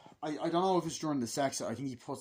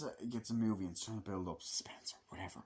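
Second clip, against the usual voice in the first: breathy whispered aside, dropped low; 4 semitones lower, 7 dB quieter than usual. Real, not acted. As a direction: whisper it off to the side like a grudging concession. Muttered aside about movie.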